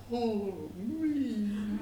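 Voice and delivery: soft, high, feeble moan; woeful cry. A narrator's reading. Wagoner with third horse dead, soft high lament. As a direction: let the wail shrink to a weak lament. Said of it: "he cried".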